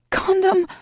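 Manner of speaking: high, loud yell. A narrator's reading. Heroine yelling a warning to her lover. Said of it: "I yell".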